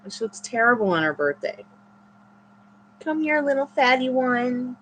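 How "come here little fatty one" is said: cooing pet talk. Real, not acted. Owner coaxing her dog over, higher cooing pet talk. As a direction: coo at the pet fondly.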